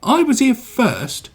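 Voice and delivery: slow, high, indignant; sniffy. A narrator's reading. Engine refuses to budge, sniffy. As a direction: stand your ground, drawn out. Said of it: "sniffed Henry".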